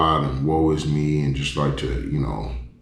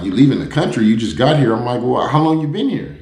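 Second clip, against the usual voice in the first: surprised; 8 semitones higher, 4 dB louder than usual. Retold or imitated. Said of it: "So I'm like".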